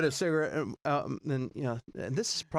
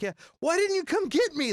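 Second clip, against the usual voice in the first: much higher and louder protest; indignant cry; 6 dB louder than usual. Retold or imitated. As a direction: complain loudly at his friends. Upset he was left out.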